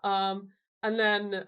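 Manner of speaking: ordinary talk, nothing marked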